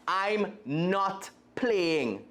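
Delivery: slow, drawn-out hurt protest; boy sulking after losing the toss; stung cry. Acted. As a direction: drag it out, wounded. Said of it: "I cried, stung".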